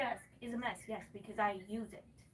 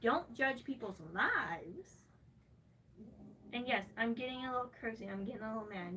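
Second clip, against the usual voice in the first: usual speaking voice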